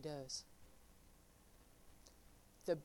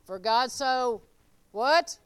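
prompting the congregation; 8 dB louder, slower than usual. Shown as a demonstration. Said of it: "most sinners know John three sixteen".

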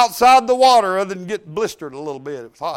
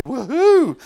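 mock woohoo; 4 semitones higher than usual. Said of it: "How can I say".

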